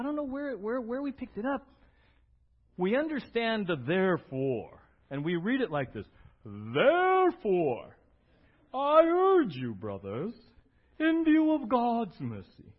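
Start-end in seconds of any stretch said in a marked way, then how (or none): 6.4-12.7 slow, heavy, overly solemn scripture reading. Shown as a demonstration. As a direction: read it like a grave pronouncement. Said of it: "we hear it like that, and we get very serious".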